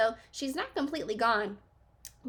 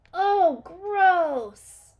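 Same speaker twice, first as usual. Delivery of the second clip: high, loud squeal of disgust; loud grossed-out cry; 8 semitones higher, 11 dB louder than usual. A narrator's reading. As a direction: recoil from something gross. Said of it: "cried Annie".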